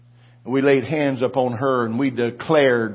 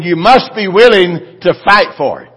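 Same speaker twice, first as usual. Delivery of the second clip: repeated louder, hammering MUST; forceful preaching shout; 6 semitones higher, 11 dB louder than usual. Shown as a demonstration. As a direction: say it again with full pulpit force. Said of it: "I said".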